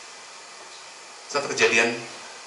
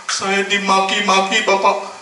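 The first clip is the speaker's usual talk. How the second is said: slow, higher, louder, distressed; upset complaint; 8 semitones higher, 5 dB louder, slower than usual. Retold or imitated. Office clerk telling the pastor she was cursed at, slow and upset. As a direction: tell it with hurt. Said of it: "staf kami mengatakan".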